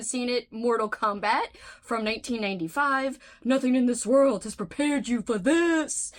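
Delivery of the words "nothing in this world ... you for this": movie trailer voice. Pretend. Dramatic trailer tagline read.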